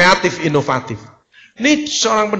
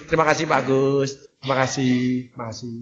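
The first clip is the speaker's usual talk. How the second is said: soft thanks fading away, lower; gracious flight attendant bowing out; stewardess thanks; 8 semitones lower, 7 dB quieter, faster than usual. Retold or imitated. Flight attendant thanking a passenger, softer and fading. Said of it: "semua pramugari mesti gini".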